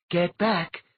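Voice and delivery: urgent warning to step away; cautioning. A narrator's reading. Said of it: "Dwyer cautioned them".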